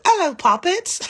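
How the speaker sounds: very high, loud English greeting; playful high-pitched English impression; sing-songy English accent. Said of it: "like, sing-songy. Like, it sounds like".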